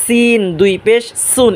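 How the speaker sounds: "সিন" and "সুন" hissed like a punctured tyre; hissing S. Shown as a demonstration. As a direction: let the s of "সিন" hiss out sharply. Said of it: "tyre পাঞ্চারের আওয়াজ".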